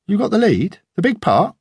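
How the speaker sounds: father thrilled at his daughter's big part; delighted surprise. Acted. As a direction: lift it up with proud delight.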